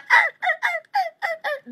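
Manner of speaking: high yippy little dog grunts, over and over; yappy poodle noises. Retold or imitated. The narrator mimics the humping poodle with high yips. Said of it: "the poodle like".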